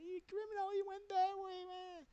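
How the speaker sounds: very high, hammed-up cry; mock wailing accusation. All pointing where there was nothing, high mock wailing. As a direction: wail it out in a put-on high voice.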